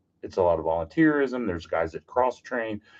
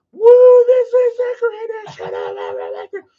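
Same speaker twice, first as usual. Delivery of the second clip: very high, loud whoop of joy; thrilled whoop; 10 dB louder, slower than usual. Retold or imitated. Calling out inside the burn building, an excited whoop. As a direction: whoop like a kid in the flames.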